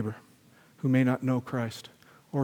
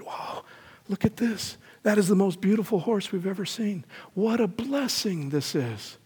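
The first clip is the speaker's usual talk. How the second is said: awed villagers; 7 semitones higher, slower than usual. Acted. Villagers marvel at the horse.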